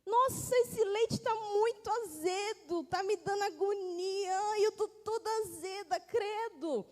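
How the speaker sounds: disgusted cup's complaint. Acted. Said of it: "ficou falando assim".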